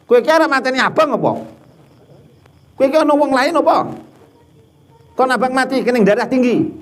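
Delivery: hammed-up Javanese husband complaining about salty soup; husband scolding. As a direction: grumble at the wife over the salty soup.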